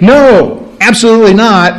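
NO and ABSOLUTELY NOT each firmly stressed; emphatic refusal. Acted. Preacher voicing Paul's answer, emphatic NO, ABSOLUTELY NOT. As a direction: shut the question down with weight on NO. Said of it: "there's that emphatic".

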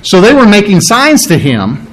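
ordinary talk

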